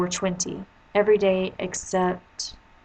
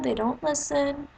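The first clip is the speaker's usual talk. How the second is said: ordinary talk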